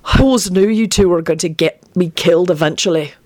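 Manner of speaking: ordinary delivery, nothing marked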